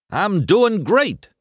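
high, drawn-out exclamation; exclaiming. A narrator's reading. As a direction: stretch it out with showy gusto. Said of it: "Harv exclaimed".

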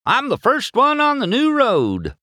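very high, loud gleeful holler; excited calling. A narrator's reading. Mater boasts from the new road, calling out. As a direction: crow it out at the top of your range. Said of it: "Mater called as he drove".

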